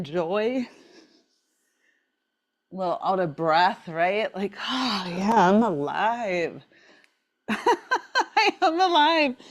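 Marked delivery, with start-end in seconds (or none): 4.6-9.4 delighted, laughing exclamation; laughing joy. Acted. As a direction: laugh out the feeling of being alive. Joyful quote while exercising.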